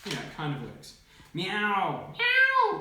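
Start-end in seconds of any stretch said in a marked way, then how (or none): 1.3-2.8 loud meows leaping very high; cat meow. A narrator's reading. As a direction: yowl like a cat, second one higher. Cat's cry in the story.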